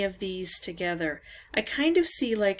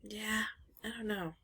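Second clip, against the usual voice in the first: whisper; 11 dB quieter than usual. Real, not acted. Giving up on the clue, muttering.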